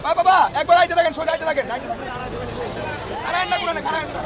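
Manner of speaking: calling out. Real, not acted. Directing the cow forward.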